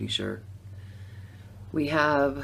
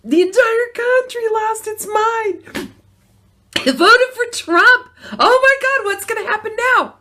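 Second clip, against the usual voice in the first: much higher, louder mock alarm; mock panic; 11 dB louder than usual. Describing the country's reaction, high loud mock panic. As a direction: wail it like the sky is falling.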